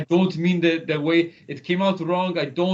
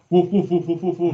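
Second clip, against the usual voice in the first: barking dog; 3 semitones higher, 6 dB louder than usual. Retold or imitated. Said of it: "I wasn't like".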